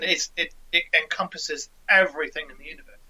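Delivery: ordinary talk, nothing marked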